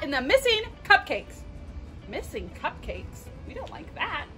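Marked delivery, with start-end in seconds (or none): none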